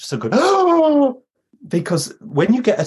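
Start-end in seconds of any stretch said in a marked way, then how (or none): 0.4-1.1 big, loud mock gasp; imitated shocked gasp. Storyteller acting out the audience's shock, loud gasp. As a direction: gasp as a whole shocked room would. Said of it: "which we all gasped".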